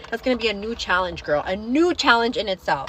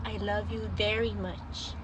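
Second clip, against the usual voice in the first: slow, soft, savoured words; tender; 10 dB quieter, slower than usual. Retold or imitated. Daughter quoting mom's text back: slow, soft tenderness. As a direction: stretch it out gently and quietly.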